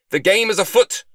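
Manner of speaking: loud, high battle cry; triumphant proclamation. A narrator's reading. Detective launches into the case in the library, triumphant. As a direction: proclaim it at full volume.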